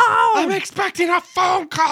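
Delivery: very high, whiny mock holler; hammed-up bratty screech; kid yelling. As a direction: yell it like an impatient teenager. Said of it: "the kid is still yelling".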